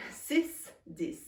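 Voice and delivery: Six and dix both with a sharp ee vowel; rhyming French numbers. Shown as a demonstration. Teacher showing six and dix rhyme. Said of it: "same pronunciation".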